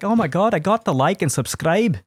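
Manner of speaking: excited, high-pitched Irish fan; Irish accent. Acted. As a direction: gush in a put-on Irish accent. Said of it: "Ireland that are like".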